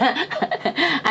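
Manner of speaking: real amused giggle; quiet chuckle at your own joke; short, breathy run of laughter. Real, not acted. Laughs at own silly aside.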